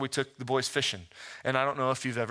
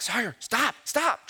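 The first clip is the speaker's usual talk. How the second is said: calling to stop; 9 semitones higher, slower than usual. Retold or imitated. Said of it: "So I'm like".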